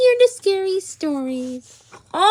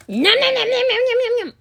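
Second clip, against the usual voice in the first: mock munching; 7 semitones higher, much faster than usual. Eating pretend Halloween candy.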